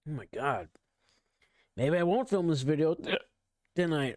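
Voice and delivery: hiccups breaking the words; hiccuping. Real, not acted. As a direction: speak between hiccups. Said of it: "I'm hiccuping really bad".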